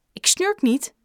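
high indignant protest. A narrator's reading. Boy denies snoring to the shopkeeper, indignant protest. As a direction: deny it sharply, voice jumping up. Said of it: "protesteerde Joe".

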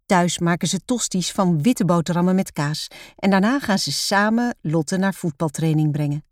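ordinary delivery, nothing marked

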